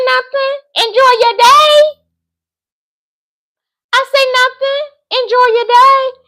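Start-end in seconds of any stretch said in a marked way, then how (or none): 0.1-2.0 very high, falsely pleasant dismissal; airy brush-off. Retold or imitated. Woman denying to the caller anything is wrong, high airy brush-off. 4.4-6.2 very high, falsely pleasant dismissal; airy brush-off. Retold or imitated. Woman denying to the caller anything is wrong, high airy brush-off. Repeats her retold brush-off.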